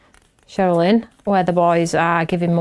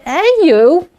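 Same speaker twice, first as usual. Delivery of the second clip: higher, louder playful scold; mock scolding; 8 semitones higher, 5 dB louder than usual. Mum calling out her laughing son, playful scold. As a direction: call him out cheekily. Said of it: "I'm just like".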